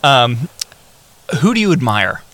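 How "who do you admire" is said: usual speaking voice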